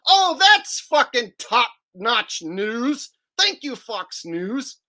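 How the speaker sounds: slow sarcastic mock praise. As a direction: drag it out with sarcasm. Mocking headline.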